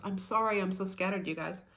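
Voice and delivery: ordinary talk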